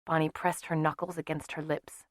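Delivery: usual speaking voice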